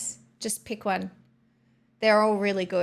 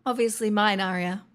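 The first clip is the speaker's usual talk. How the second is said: ordinary delivery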